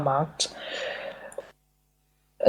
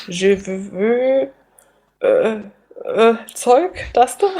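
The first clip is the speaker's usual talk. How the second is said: halting broken French, rising higher; stumbling tourist pointing at pastries; fake foreign language; 3 semitones higher, much slower than usual. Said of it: "Mit meinem Fantasiefranzösisch".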